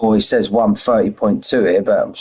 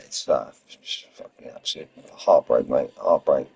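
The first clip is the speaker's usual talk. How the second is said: heartbroken; 6 semitones lower, 6 dB quieter than usual. Real, not acted. Talking about missing children.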